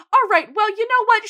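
loud, high-pitched blurting; acted outburst. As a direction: burst out high and loud, play-acting. Acted outburst after the silence.

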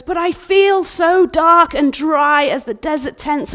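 loud lamenting bride. Acted. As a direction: cry out your dryness. Bride voiced lamenting dryness.

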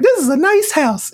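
very high-pitched girlfriend impression; mock girlfriend voice. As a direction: put on a high, girly voice. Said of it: "the girlfriend's like".

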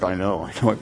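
low, soft, defeated reply; mumbled admission from a beaten fighter; discouraged boxer. Retold or imitated. Beaten boxer answers his manager.

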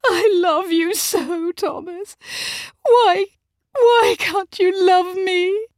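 high, loud, drawn-out wail; desperate, heartbroken wife; tearful pleading. A narrator's reading. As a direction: beg for love through sobs. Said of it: "she said between sobs".

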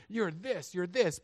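high, punchy marital sniping; mock accusations. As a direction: fire blame like shots at a spouse. Acts spouses firing accusations in fights.

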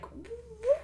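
high, quiet stunned what; shocked farmer. Retold or imitated. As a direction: gasp it out in disbelief. Furious farmer quote.

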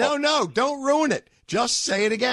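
high, eager insistence; excited plea. Real, not acted. Host eagerly urging the caller, excited high plea. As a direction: beg him not to ruin it. Host urges him not to ruin it.

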